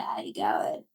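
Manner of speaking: mumbled low, words run together; slurred Aussie greeting. Retold or imitated. Imitating the slurred pub greeting to a friend. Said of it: "started to speak like that".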